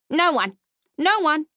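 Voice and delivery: high, loud insisting goblin. Acted. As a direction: wave it off as the goblin.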